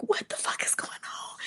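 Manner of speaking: hushed, soft disbelief; retold stunned whisper. Bystander watching the fight, whispering in shock.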